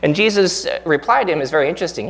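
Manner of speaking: ordinary talk, nothing marked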